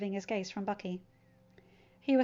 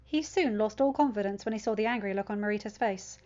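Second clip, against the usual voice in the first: ordinary delivery, nothing marked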